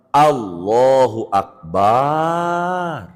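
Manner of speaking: Allahu Akbar slow, with the ba of Akbar drawn out; wrong long ba. Shown as a demonstration. Teacher demonstrating the forbidden long ba. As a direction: stretch the ba in Akbar too long. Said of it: "ba akbarnya jangan sampai panjang".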